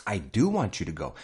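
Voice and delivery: emphatic DO to insist it's true; stress on DO. Shown as a demonstration. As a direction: lean on "do" to insist. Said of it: "to emphasize that it really happened".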